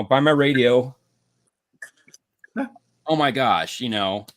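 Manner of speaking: ordinary talk, nothing marked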